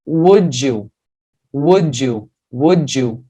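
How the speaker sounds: "Would you" run together as "wou-dju"; D plus Y linked. Shown as a demonstration. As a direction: repeat "Would you" with a J sound joining the words. ("Would you" emendado, com som de dju)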